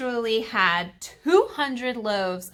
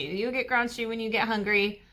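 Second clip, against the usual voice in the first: normal talking voice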